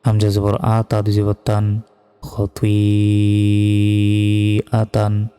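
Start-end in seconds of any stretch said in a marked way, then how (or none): 2.2-5.3 খতুইআতান with the vowel held four counts, very slow; four-count elongation. Shown as a demonstration. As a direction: stretch the long vowel a full four beats. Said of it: "এখানে চার second টান হবে".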